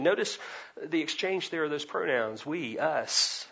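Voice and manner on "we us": usual speaking voice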